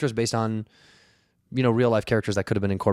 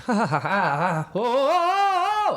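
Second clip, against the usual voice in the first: manic laugh rising into a high, drawn-out, much slower oh; panicked yelling laugh; 13 semitones higher, slower than usual. Retold or imitated. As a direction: lose it completely as the lift climbs. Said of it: "I started yelling as loud as I could".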